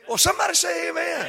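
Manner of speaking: high, loud call to the congregation; calling for amen. Real, not acted. Preacher asking congregation for an amen, loud high call. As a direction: rouse the room for an amen.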